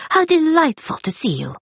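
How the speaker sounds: high, eager welcome from a small shopkeeper; a lightly voiced little man, pleased; delighted librarian. A narrator's reading. Librarian greets Rosalind.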